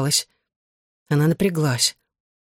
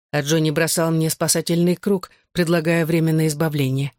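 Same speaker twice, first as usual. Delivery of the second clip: plain reading voice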